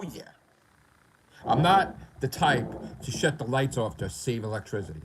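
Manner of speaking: normal talking voice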